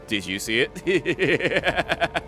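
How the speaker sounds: slow words broken by laughter; laughing. Real, not acted. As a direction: laugh through the question. Laughing at the chaos.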